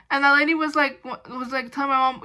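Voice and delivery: normal talking voice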